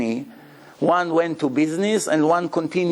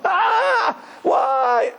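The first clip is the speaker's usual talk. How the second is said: wailing cry. Acted. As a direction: cry out to God in despair. Dying man cries out to God.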